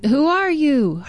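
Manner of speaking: put-on boy calling high into the woods. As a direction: shout the question into the trees. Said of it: "He heard an echo".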